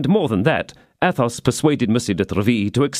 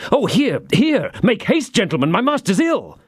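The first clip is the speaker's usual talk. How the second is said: high, urgent calling out for help; urgent cry for help; 11 semitones higher than usual. A narrator's reading. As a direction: shout for the gentlemen to hurry. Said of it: "cried Planchet".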